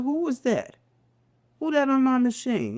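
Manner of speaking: high, startled retelling of hearing his own recorded voice; retold disbelief. Man hearing his recorded voice, high startled disbelief. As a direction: pitch it up high, baffled at the tape. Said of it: "and I said".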